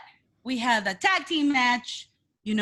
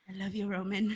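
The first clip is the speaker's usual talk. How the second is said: swooning quietly; 6 semitones lower, 10 dB quieter, faster than usual. Retold or imitated. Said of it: "And I'm like".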